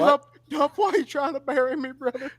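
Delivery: high, whining impression of him; a hint of a wounded buddy; mock whiny voice. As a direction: play him as hurt and pleading. Mocking a guy getting in van.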